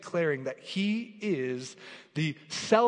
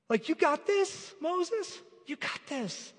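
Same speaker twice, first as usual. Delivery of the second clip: mock pep talk; 14 semitones higher, slower than usual. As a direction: hype him up in a lifted, mocking tone. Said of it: "God doesn't say".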